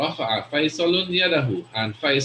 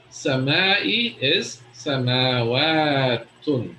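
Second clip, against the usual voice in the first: samawatun spelled out very slowly; slow, careful samawatun; much slower than usual. Shown as a demonstration. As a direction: stretch each syllable of samawatun. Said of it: "the plural of".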